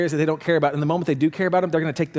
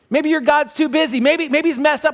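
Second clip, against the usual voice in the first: mocking taunt; 8 semitones higher, 4 dB louder than usual. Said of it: "Elijah is mocking them".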